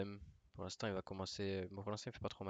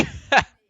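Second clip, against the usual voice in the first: loud disbelieving laugh burst; 25 dB louder than usual. Real, not acted. Laugh after screaming.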